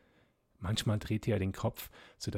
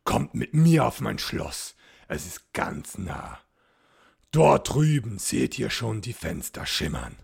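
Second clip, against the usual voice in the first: looming, coaxing ghostly knight, louder; sinister ghost count; 7 dB louder than usual. A narrator's reading. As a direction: lure them in with a dark, loud invitation.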